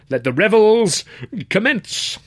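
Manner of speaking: high, loud showman call; grand announcement. A narrator's reading. Master of ceremonies opens the revels, loud and high. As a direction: announce it with a flourish.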